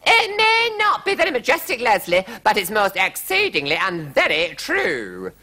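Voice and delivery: high, loud, indignant queen; very high royal protest; queen's retort. A narrator's reading. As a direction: answer back shrilly as an affronted queen.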